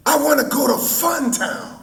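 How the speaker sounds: put-on very high, pleading seven-year-old girl; Yolanda King. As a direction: squeal it like a little child begging Daddy. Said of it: "she, being seven years old".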